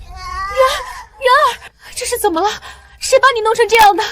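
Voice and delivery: high, tearful cry of alarm; distraught mother calling to her hurt child. Acted. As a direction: voice rising in anguish.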